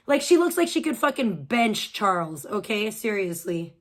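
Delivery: ordinary talk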